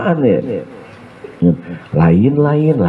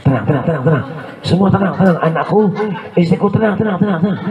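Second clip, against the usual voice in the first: 1 panicky husband; 8 semitones higher, faster than usual. Retold or imitated. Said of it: "Kata suami".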